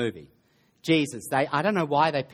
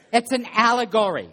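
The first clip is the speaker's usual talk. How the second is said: louder, higher, driving home ALLEGORY; insistent emphasis; 8 semitones higher, 6 dB louder than usual. Real, not acted. Preacher repeats the point to the congregation, insistent and loud. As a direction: hammer "allegory" so nobody misses it.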